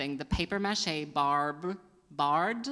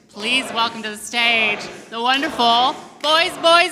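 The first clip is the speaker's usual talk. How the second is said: much louder and higher, hyped stage intro; announcer intro; 9 semitones higher, 13 dB louder, slower than usual. Real, not acted. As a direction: hype the crowd for the next act. Host introducing act.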